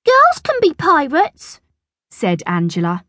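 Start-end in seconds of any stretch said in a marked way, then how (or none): 0.1-1.6 put-on high-pitched childish protest; acted playground defiance; defiant little girl. A narrator's reading. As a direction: argue back in a girl's high voice. Angela argues back.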